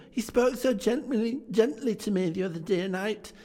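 put-on high, eager child voice; little Fran telling her brother the good news, high and excited; little girl. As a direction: chirp it like an excited little sister. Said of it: "said the child".

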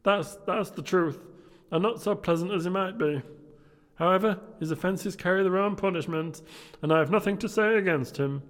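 high, loud put-on young gentleman; merry nephew. Nephew telling guests about his comical uncle, high loud voice. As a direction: pitch it high and project.

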